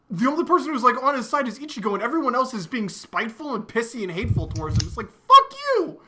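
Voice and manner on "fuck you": much higher, louder shouted curse; hammed-up defiant yell; mock furious yell. Hero cursing hateful teammates, mock furious yell.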